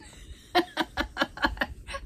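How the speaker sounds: high burst of real laughter; helpless run of giggles; laughing. Real, not acted. Laughing at retold complaint.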